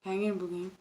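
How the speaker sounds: soft, indistinct mock mumble; mumbling imitation. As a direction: swallow the words like a mumbling customer. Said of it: "people mumble".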